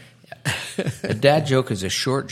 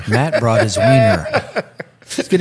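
laughing; 2 semitones higher, 7 dB louder than usual. Real, not acted. Laughing while teasing.

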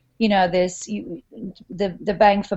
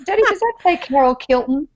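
put-on higher, louder little-kid voice; pitched-up imitation of a child asking Daddy; child's question; 8 semitones higher, 3 dB louder than usual. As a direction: imitate a daughter puzzling over a word. Voices daughter reading a label.

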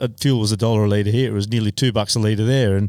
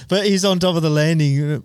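ordinary talk, nothing marked

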